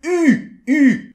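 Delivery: "U" high and loud, lips rounded as for OU with tongue set for I; French U. Shown as a demonstration. Teacher loudly demonstrating the French U. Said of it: "comme le OU, et vous essayez de faire un I".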